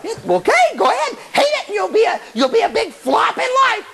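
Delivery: high, scolding little voice; jeering voice in the head; nagging inner voice. Acted. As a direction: rant in a high mocking pitch. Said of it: "everybody's got this little voice".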